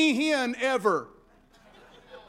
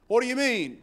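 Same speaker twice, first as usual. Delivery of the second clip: ordinary delivery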